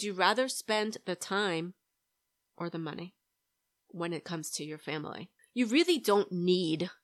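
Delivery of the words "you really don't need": slow, louder, with need pressed hard; stress on need. Real, not acted. Telling listeners what they don't need, stressing need. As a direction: lean on need. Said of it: "I'm emphasizing the word need".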